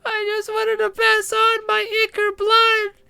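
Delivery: tiny high wailing, slow; pixie. Acted. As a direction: wail for the dying babies, tiny and shrill. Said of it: "a tiny voice".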